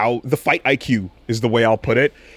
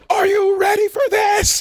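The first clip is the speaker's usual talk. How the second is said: very high screamed hype line; high-pitched hammed-up shout; imitated song shout. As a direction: scream it like a rave hype man. Re-enacting shout from entrance song.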